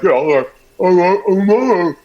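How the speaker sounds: "all right" mumbled with the tongue held back; tongue tucked in. Retold or imitated. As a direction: imitate saying "all right" without sucking the tongue, words smeared. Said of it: "I was talking to her like".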